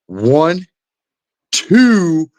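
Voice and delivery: building count, last number loud and high; counting up the combo. Retold or imitated. Opponent counting his combination, rising to a loud high three. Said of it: "he goes".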